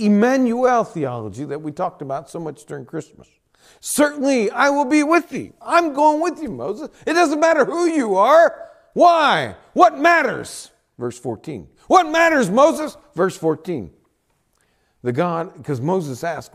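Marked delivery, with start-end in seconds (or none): 3.8-10.7 high, loud preaching as God; ringing, insistent declaration; emphatic assurance. Acted. Preacher voices God's forceful assurance. 11.9-13.0 high, loud rhetorical demand; ringing preacher's challenge as God; forceful challenge. Acted. Preacher voices God challenging Moses.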